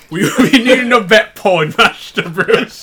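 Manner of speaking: loud, high, hammed-up English butler; English accent. Shown as a demonstration. Answering a listener's email, hammed-up English accent. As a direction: put on a big comic English accent. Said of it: "here's an English accent for you".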